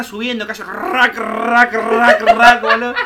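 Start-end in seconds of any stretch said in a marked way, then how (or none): 0.6-2.6 loud rattling blind noise; harsh, rhythmic ratchet sound, building louder; imitated blind rattle. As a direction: crank out the noise of a rising shutter. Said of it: "me despiertan con el sonido del, de la persiana".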